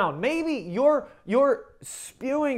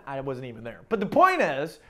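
ordinary talk, nothing marked

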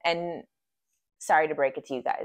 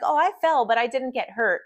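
lilting higher voice, imitating amusement; amused, cute; 6 semitones higher, 3 dB louder than usual. Retelling her client's fall in the session, amused cute voice. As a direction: mimic someone tickled by their own tumble. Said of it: "And she's laughing".

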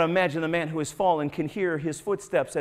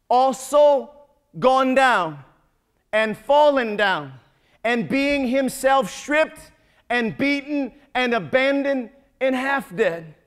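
slow, building preaching; 2 semitones higher, slower than usual. Acted. Preaching climax on the Samaritan.

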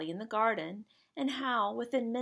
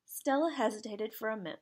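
ordinary delivery

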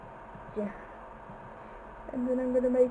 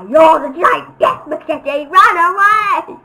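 loud, higher fake panic; mock panic; 4 semitones higher, 10 dB louder than usual. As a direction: yell it like fleeing in a cartoon.

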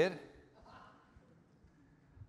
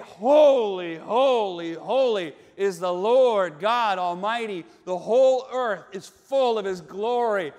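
lightly voiced angelic proclamation, louder; proclaiming angels; 8 dB louder than usual. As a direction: declare it with solemn force. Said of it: "And they say".

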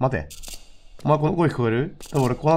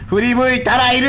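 jumpy anticipation; 14 semitones higher, 7 dB louder than usual. Real, not acted. Bracing for a scare.

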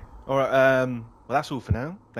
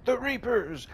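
high, hammed-up zealot cry; high mock-fanatic voice, slower on the middle line; mock crazed prophet; 9 semitones higher, slower than usual. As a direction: play the mad cultist, over the top. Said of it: "turns up one day and goes".

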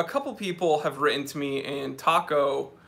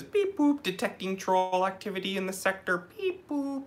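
robot voice; 3 semitones higher than usual. Retold or imitated. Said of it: "Shutdown California says".

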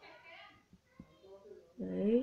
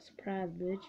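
soft, slightly lowered ambush taunt; understated little taunt; sly voiced quote; 3 semitones lower, 6 dB quieter than usual. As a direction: say it quietly, as the lurking unit. Voicing units that ambush.

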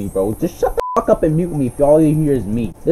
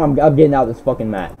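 ordinary talk, nothing marked

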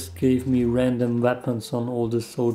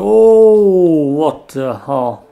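drawn-out shocked yell, much louder and higher; a real jolt of surprise, stretched long; loud stretched-out what the hell; startled yell; 4 semitones higher, 13 dB louder, much slower than usual. Real, not acted. Sudden enemy surprise.

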